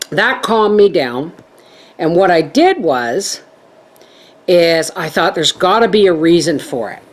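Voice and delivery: normal talking voice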